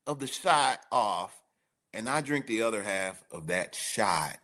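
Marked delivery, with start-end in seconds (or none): none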